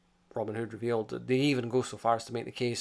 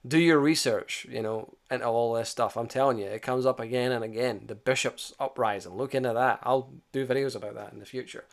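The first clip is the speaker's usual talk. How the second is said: ordinary talk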